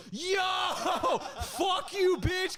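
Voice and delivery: high, hollered "yo" through laughter; very high-pitched mock jeering; imitated party uproar. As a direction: whoop like a crowd reacting to a burn. Retold party's uproar at an insult.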